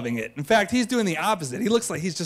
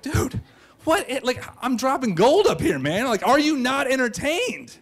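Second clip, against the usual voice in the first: high-pitched, exasperated showman; mock indignation; 6 semitones higher than usual. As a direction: demand their approval, pitched up and incredulous. Acted indignation at the unimpressed listener.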